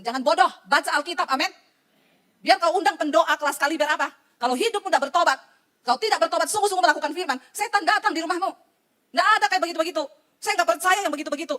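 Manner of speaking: loud, emphatic rebuke from the pulpit; forceful preaching. Real, not acted. Preacher warns congregation that unrepentance invites Satan, forceful and loud. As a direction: drive each phrase home at full volume.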